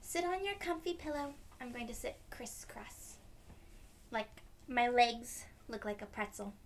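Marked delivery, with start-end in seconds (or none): none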